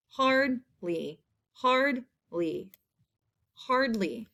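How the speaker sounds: "hard... ly", a clear gap each time; syllable by syllable. Shown as a demonstration. Teacher showing the first half, "Hardly" split in two. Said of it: "in the first half of the word".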